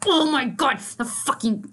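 normal talking voice

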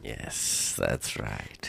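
hushed yes; whispering. Acted. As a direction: whisper agreement. Whispered secret banter.

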